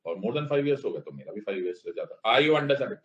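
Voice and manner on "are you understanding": usual speaking voice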